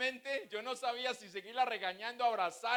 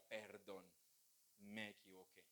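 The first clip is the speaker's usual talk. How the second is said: much softer, lower, humbled "Perdón"; meek apology; 12 semitones lower, 19 dB quieter than usual. Retold or imitated. Rebuked man apologizes to his pastor, softly and meekly. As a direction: say it small, beaten down. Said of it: "en donde uno decía".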